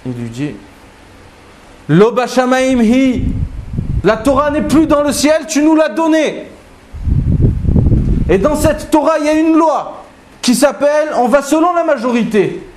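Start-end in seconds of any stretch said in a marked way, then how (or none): 1.9-12.6 beaucoup plus aigu, ardent et solennel; Rabbi Yoshua véhément. Acted. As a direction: tiens tête au ciel, voix portée très haut. Rabbi Yoshua répond à Hachem. (Rabbi Yoshua répond à la voix céleste, aigu et ardent)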